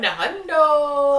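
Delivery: slow, loud stretched hundo; drawn-out hundo. Real, not acted. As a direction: drag out hundo playfully. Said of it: "hundo slang drawn out".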